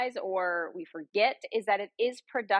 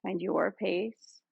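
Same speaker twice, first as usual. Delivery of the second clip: guided meditation; 3 semitones lower than usual. Real, not acted. Yoga guide leading friends through breath and body scan, guided meditation. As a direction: ease them into stillness, lower and unhurried.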